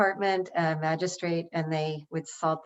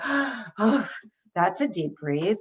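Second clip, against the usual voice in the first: exasperated sigh; slower than usual. Shown as a demonstration. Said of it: "when we're exasperated and we go".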